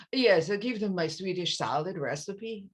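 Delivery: hammed-up Swedish accent, sing-song and stiff; bad Swedish accent. Author mocking a publicist's Greta Garbo with a terrible Swedish accent. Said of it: "terrible Swedish accent".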